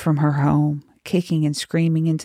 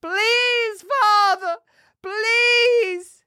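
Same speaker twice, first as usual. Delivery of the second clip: very high, wailing plea; final desperate cries; much slower than usual. A narrator's reading. Girl crying to her father from the flames, high wailing. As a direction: wail it high, drawing out each word. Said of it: "final cries".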